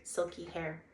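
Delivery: usual speaking voice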